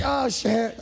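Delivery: high mock alarm. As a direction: fake sudden panic. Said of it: "She was like".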